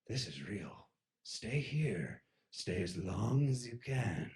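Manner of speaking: low, soft, slow coaxing voice; quiet low persuading dream voice; dream figure. Retold or imitated. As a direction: lure them to stay, low and hushed. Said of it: "someone always comes up and they're like".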